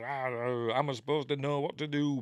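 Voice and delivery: hammed-up frustration; gets angry. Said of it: "gets angry".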